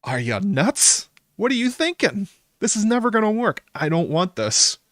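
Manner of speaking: high, disbelieving mock outburst; shrill-free high pitch, baffled and appalled; incredulous women. Women scoffing at Cole's plan, high and incredulous. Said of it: "both the women are like".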